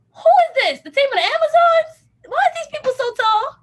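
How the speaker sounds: high, loud, laughing disbelief; half-laughing shocked squeal; amazed disbelief. Retold or imitated. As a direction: squeal at how tall they are. Stunned by tall strangers.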